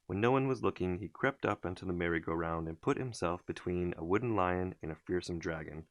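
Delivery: ordinary delivery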